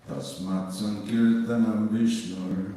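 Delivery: Tasmat Sankirtanam Vishnur said slowly, loud, each syllable clear; slow recitation for repeating. Shown as a demonstration. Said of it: "So please repeat after me".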